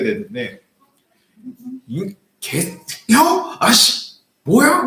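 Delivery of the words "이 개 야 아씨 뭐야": much higher, louder, slow angry curse; swearing outburst. Retold or imitated. Pastor swearing back at the old man, retold anger. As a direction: let the curse burst out. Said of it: "욕을 하면서".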